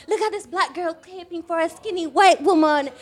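high, loud mock outrage; mock commenter voice; mocking critics. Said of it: "and they're like".

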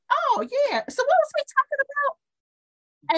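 high, airy daze, like just waking up; a lightly acted stunned player coming to; dazed and confused. Dazed player after VR game.

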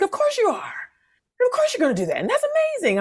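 very high, gushing cheerleader voice; imitating a child's unconditional hype; Taj. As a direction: cheer them on in a high, adoring squeal. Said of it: "Taj is just cheering on".

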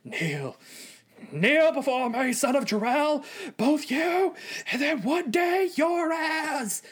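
high, campy villain bellow; hammed-up General Zod command; slow theatrical villain menace. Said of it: "the perfect campy villain".

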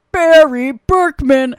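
put-on slow, loud drunken announcement; loud slurred name drop; drunk call-out. Drunk Ryan calling out name.

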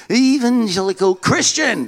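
high-pitched, eager discovery; excited realisation. Acted. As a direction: announce it as a thrilling revelation. Young convert's naive discovery.

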